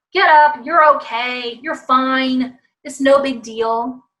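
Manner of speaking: loud opening command, then emphatic dismissal; brusque parent. Acted. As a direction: imitate a parent brushing off a child. Acts brusque parent dismissing child.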